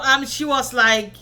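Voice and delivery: ordinary talk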